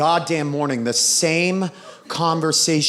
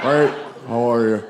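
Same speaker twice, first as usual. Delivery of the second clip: creepy weirdo hello, pitched down; Buffalo Bill; 6 semitones lower than usual. Retold or imitated. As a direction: answer deep and off-putting to repel them. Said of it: "taking on that voice like Buffalo Bill".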